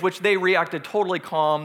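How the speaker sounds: ordinary talk, nothing marked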